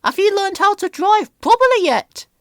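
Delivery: put-on cheeky old lorry; Elizabeth, unimpressed. A narrator's reading. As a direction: tease an old acquaintance. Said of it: "said Elizabeth".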